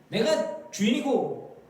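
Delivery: mock self-appointed master; bossy master. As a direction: lord it over a servant, slowly. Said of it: "그거는 종의 자세가 아니죠".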